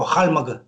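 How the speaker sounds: «хальмаг» said the Kalmyk way, starting with a kh sound; Kalmyk pronunciation. Shown as a demonstration. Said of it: "калмыки произносят его".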